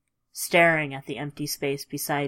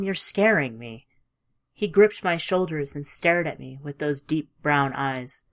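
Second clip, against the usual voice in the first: plain reading voice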